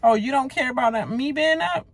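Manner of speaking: high mocking echo of Dan. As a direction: parrot his whine back at him. Mimicking Dan's question.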